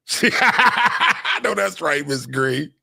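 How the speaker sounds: high, slow, laughing praise; delighted cackle of agreement; amused high-pitched approval. Real, not acted. Laughing approval of viewer answer.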